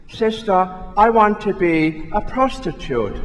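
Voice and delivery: put-on girl's high Northern Irish lilt; little Susie answering sister in a Belfast accent. Priest telling a joke, Susie answers the nun in a Northern Irish accent. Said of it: "in an Northern Ireland accent".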